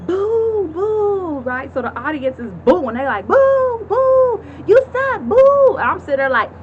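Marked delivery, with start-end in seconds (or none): 0.1-1.4 mouthed boo. Retold or imitated. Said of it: "She's like". 3.3-5.8 loud, high jeering boos; a high, loud crowd boo. Retold or imitated. Audience booing on cue.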